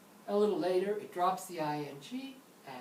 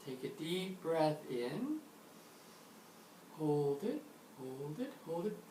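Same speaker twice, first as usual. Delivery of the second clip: guided breathing; slower than usual. Shown as a demonstration. Leads a breathing exercise.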